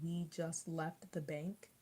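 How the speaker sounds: "We just left the bank" drawn out slowly; slow effortful recall. Retold or imitated. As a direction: drag it out as if searching for words. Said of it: "I have to come up with".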